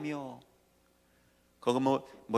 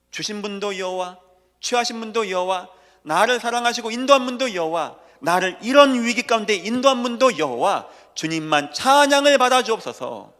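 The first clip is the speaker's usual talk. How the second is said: preaching at full force; 7 semitones higher, 9 dB louder than usual. Acted. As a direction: pound each word out to the congregation. Building praise litany.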